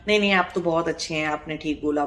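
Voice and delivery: quick, soft, falsely sweet praise; sweetly, hiding anger. Retold or imitated. To someone who angers her, mock sweet placating praise. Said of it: "हमने उसको प्यार से बोला".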